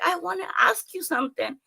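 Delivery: put-on high, soft child's voice; hushed imitation of a child; imitated young son. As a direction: ask softly, like a shy little boy. Mother voices her young son.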